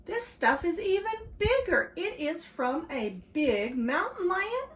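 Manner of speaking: put-on high, loud excited boy. A narrator's reading. Michael reports a big find to his family, high excited voice. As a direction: raise the pitch and push it out.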